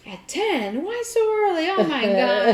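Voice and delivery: a clerk shocked the shop closed so early, high surprised voice. Retold or imitated. Clerk hears the gift shop shut at ten, surprised. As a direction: pitch jumps high in disbelief.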